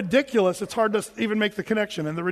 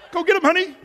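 high, raised call to the wife; mock cowardly cheering-on; 9 semitones higher, 5 dB louder, faster than usual. Husband quivering behind a light pole to his wife, mock cowardly. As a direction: egg her on from hiding, hammed-up.

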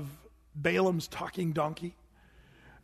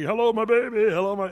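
loud, slightly raised showman donkey; a hint of a vaudeville donkey act; performing donkey; 3 semitones higher, 7 dB louder than usual. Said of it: "here's this donkey".

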